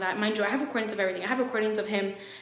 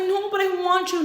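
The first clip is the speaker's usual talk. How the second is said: high, wailing mock plea; dramatic pleading; 9 semitones higher than usual. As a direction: beg him in an exaggerated high voice. Acted pleading during breakup.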